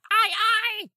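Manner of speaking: shrill, very high squawking birds. Acted. GM voicing the birds answering the player, squawking. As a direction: screech it like a flock of gulls. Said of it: "utter a resounding".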